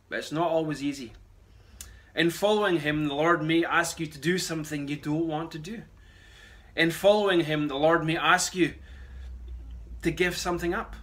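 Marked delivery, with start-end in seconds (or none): none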